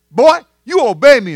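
high, loud grandma command; a little grandma laying down the law; stern grandma. Retold or imitated. Grandma ordering the big football player, high loud command. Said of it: "she says".

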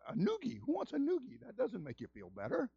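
high, soft incredulous questions; mock bafflement. Preacher reacting to knuckles in massage, mock bafflement.

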